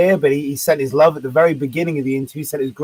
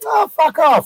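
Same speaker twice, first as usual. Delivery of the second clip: high, scornful brush-off; jeering heckler; 11 semitones higher, 4 dB louder, slower than usual. Retold or imitated. Crowd dismissing a safe comic, high jeering. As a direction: sneer it like a bored crowd member.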